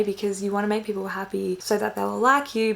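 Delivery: normal talking voice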